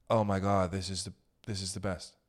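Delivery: hushed, low imitation of rapt fans; mock awe. Mimicking the entranced Radiohead crowd, hushed mock awe. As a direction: drop low and soft, mocking reverence.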